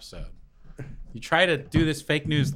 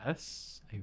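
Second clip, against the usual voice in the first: whispered; 3 semitones lower, 15 dB quieter than usual. Real, not acted. Confirming with a low sound.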